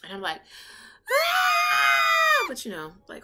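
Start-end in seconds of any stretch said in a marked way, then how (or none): 1.1-2.5 piercing high scream of terror; screaming on the kitchen floor from a pulled hamstring; horror movie scream. Retold or imitated. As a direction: let out a horror-film shriek. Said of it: "horror movie type scream".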